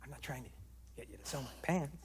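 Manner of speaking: quick, hushed whispered protest; husband whispering back to his wife; fast whisper. Acted. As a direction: whisper it fast, defending yourself.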